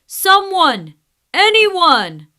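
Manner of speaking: loud, high desperate calls; trapped lion calling into the jungle, loud. Acted. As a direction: call out to anyone nearby.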